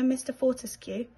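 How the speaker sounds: usual speaking voice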